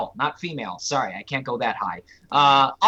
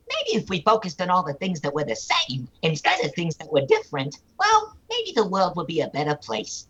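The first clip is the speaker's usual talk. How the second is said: cartoon cat dispensing wisdom; put-on creature voice, musing on life; Meowth; 3 semitones higher than usual. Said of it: "Meowth is talking to his clone".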